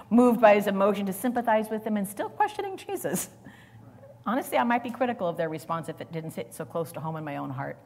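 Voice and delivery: normal talking voice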